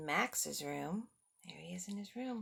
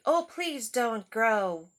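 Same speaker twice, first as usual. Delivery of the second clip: pleading monsters; 10 dB louder, slower than usual. Acted. The wild things beg Max to stay, loud and pleading. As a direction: beg him not to go. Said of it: "the wild things cried".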